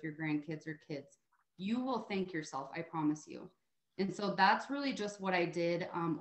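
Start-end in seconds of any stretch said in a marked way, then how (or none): none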